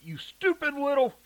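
furious high shout; angry yell. Acted. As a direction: yell at her daughter. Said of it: "Shirley yelled".

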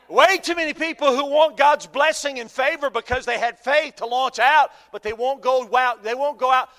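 loud, high, fervent preaching; preaching at full force. Real, not acted. Preacher warning about rail riders, loud and high. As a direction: drive it home to the congregation.

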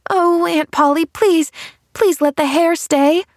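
put-on high, urgent childlike plea; pleading. A narrator's reading. Girl begs her aunt to keep the hairdo, pleading.

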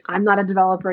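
usual speaking voice

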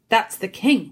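ordinary delivery, nothing marked

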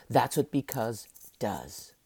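ordinary talk, nothing marked

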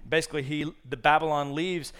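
usual speaking voice

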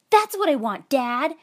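put-on high-pitched child's shout to a parent; excited kid yell. A narrator's reading. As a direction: call out eagerly like a little kid. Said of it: "I yelled".

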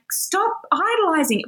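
high-pitched exasperated command; mild mock scolding. God tells people to drop their idols, mildly scolding. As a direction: lightly scold them as God would. God's command voiced for listeners.

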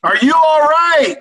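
loud, high, slow holler. Retold or imitated. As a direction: holler it across the road. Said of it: "little bit louder".

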